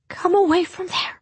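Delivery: high, urgent call. A narrator's reading. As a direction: call the dog away, scared. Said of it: "Hazel tried to call".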